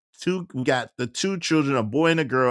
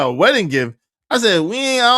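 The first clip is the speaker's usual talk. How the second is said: taken aback; 7 semitones higher, 4 dB louder than usual. Retold or imitated. Protests a surprise wedding gift.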